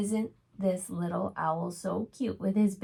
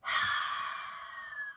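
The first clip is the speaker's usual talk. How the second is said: long sigh. A narrator's reading. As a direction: sigh like the forest is empty. Storyteller sighs lonely.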